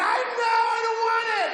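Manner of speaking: a shouted, panicked "I don't want it"; panicked yell. Retold or imitated. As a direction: blurt it out high as you bolt. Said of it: "and I just went".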